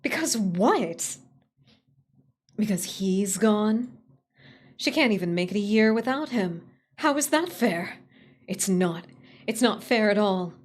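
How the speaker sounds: bitter ranting. Acted. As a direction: vent the unfairness, loud. Character rants at grief.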